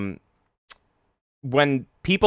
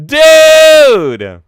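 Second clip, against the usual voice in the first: loud, much higher happy shout; excited greeting; 16 dB louder than usual. Real, not acted. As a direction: welcome a friend joyfully. Greeting a guest joining.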